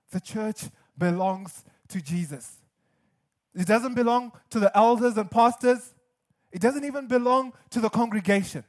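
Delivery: normal talking voice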